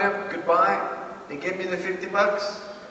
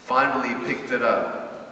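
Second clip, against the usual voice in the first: ordinary talk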